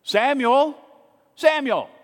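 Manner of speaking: high, loud calling of a name in the night. Retold or imitated. Voice of God calls sleeping boy, loud and high. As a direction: call out as a voice from nowhere. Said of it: "he hears it again".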